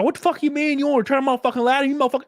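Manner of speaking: mock-furious customer service rant, high and fast; high-pitched hammed-up tirade; retold furious. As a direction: imitate her fury in a high, rushed voice. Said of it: "she was furious with me".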